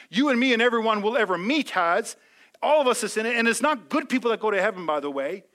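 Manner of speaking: full-force sermon climax; impassioned preaching. Acted. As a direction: rouse the crowd toward heaven.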